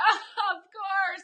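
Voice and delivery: high, loud "oh, of course" with a laugh; laughing at the bad luck; wry "of course". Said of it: "I was like".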